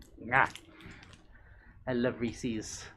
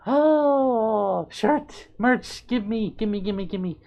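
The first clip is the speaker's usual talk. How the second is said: high, squealing give me give me; greedy excitement; 8 semitones higher, slower than usual. Retold or imitated. Streamer retelling seeing the merch, high squealing excitement. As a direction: beg for it like a kid spotting a gift. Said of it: "and I was like".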